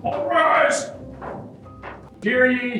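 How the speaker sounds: ordinary delivery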